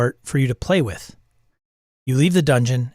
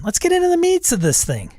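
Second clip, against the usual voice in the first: excited. Real, not acted. Excited gamer announcement.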